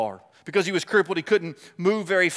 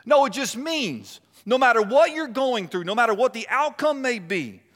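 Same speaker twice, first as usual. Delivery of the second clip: raised, driving pulpit voice; fervent preaching; 5 semitones higher, 4 dB louder than usual. Acted. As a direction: lift the congregation with conviction. Preaching, voicing the Lord.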